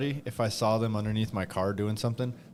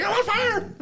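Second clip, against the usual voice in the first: high panicked wail; hammed-up burning guy cry; mock panic; 6 dB louder than usual. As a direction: shriek it like he's burning. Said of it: "He's like".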